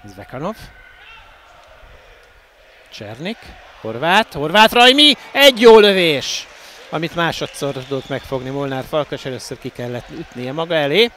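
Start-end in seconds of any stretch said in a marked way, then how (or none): 3.8-6.5 louder, higher as the shot comes; rising excitement on the shot; excited commentary. Real, not acted. Striker shoots, commentator rises. (izgatott kommentár)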